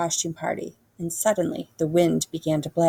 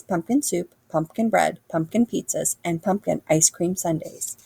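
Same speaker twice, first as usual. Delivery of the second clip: plain reading voice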